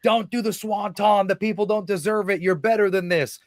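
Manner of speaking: high, pleading mock yell; retold yelling. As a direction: call it out as an imitated warning. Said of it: "Matt turns and yells at him".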